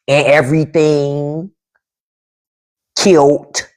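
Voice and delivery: Washington DC city accent, word by word; spaced-out DC accent samples. Shown as a demonstration. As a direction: show off DC slang pronunciations. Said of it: "easier for me to sound like I'm from D C".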